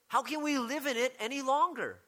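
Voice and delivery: high, ringing rhetorical ask; rhetorical question. Retold or imitated. Preacher quoting Paul to the congregation, high rhetorical question. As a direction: lift it as if the answer is obvious. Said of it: "he rhetorically asks".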